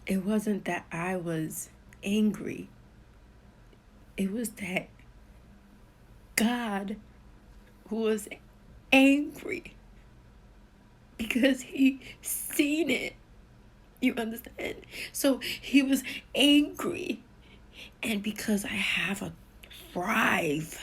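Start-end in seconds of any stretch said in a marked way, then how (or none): 6.4-17.2 sudden loud outburst. Real, not acted. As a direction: voice surges high and loud on God's anger. Climax, God's anger revealed.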